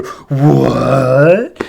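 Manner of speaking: hammed-up disbelieving yelp; mock astonishment. Hero cracks open the book, hammed-up shock.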